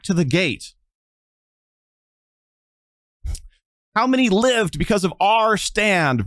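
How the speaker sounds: high, impassioned defence, building to a cry; rally the crowd, voice high and fierce; defiant outburst. Acted. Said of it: "she's like".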